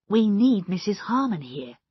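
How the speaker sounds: plain reading voice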